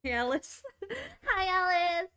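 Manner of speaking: loud gushing hello; excited greeting. Real, not acted. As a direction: greet her like a thrilled fan. Streamer greets game character excitedly.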